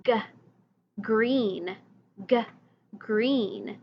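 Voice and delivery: G sounds like g in "green", hard and clear; teacher sounding out "green"; letter sound model. Shown as a demonstration. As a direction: model "G, green" slowly for children. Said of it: "So G sounds like".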